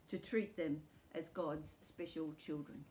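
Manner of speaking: normal talking voice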